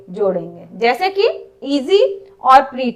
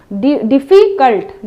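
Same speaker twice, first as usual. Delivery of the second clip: Difficult said slowly, split into its syllables; teacher drawing out डि- then difficult so pupils hear each part; slow classroom model of difficult, syllable by syllable; slow syllable demo; 2 semitones lower, slower than usual. Shown as a demonstration. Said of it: "difficult ऐसे बोलते हैं".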